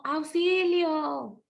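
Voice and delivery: high, drawn-out cry for help; mock call for help, stretched out; exclamation. Shown as a demonstration. Teacher acts a cry for help for pupils to classify, exclaiming. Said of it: "Exclamación".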